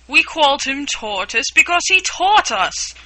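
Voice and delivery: loud, high, cross snap at a dull question; a touchy turtle scolding Alice; angry retort. A narrator's reading. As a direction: bark it out, offended. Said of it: "said the Mock Turtle angrily".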